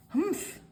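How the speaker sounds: high, muffled humph through bandages; bandaged up, can only go humph. A narrator's reading. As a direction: grunt it out bandaged up. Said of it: "he cried".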